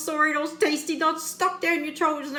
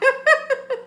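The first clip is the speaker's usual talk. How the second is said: high-pitched laugh; bursting into a high laugh; 13 semitones higher, 5 dB louder than usual. Real, not acted. Laughing at own joke.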